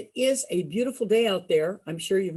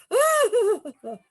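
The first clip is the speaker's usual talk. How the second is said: mock scream into laugh; 10 semitones higher than usual. Mock scare, then laughs.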